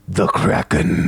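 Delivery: slow, low, hushed dread; ominous pirate whisper. Acted. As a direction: breathe the name like a curse. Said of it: "the pirate next to you goes".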